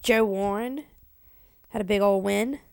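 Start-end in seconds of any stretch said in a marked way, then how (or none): none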